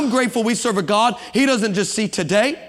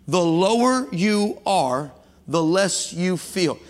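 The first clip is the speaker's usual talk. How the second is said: slow; much slower than usual. Acted. Repeating the punchline for weight.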